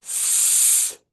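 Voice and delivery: s- as an unvoiced hiss; s- with no voice, just air; voiceless s. Shown as a demonstration. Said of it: "can we go".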